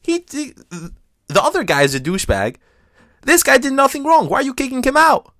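crowd protest. Retold or imitated. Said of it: "It was like".

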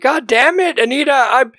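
husband exploding at his wife over the hidden medicine; set off, furious. Retold or imitated. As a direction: blow up at the wife in a hammed-up rage. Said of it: "That sets him off".

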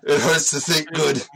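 put-on Austrian accent, stiff and heavy; lightly voiced Austrian action hero; Arnold Schwarzenegger impression. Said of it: "Now you're fucking Arnold Schwarzenegger".

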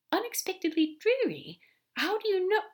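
high, startled questions; astonished. Acted. Aziraphale catching Crowley's hint, astonished. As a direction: echo it in rising astonishment. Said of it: "Aziraphale gasped".

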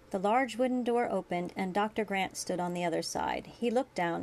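usual speaking voice

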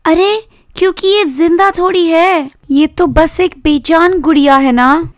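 put-on high-pitched girl explaining and pleading; high, pitying girlish plea; protective little sister. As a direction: defend the doll in a high sister voice.

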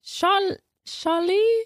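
put-on high, tentative French accent. Host asked for Charlie in an accent, high French accent. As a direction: try the name in a squeaky French voice. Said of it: "can you say Charlie in an accent?".

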